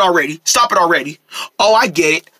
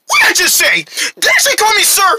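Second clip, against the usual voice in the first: indignant scolding; 9 semitones higher than usual. Acted. Scolding over his new title.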